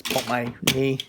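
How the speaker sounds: normal talking voice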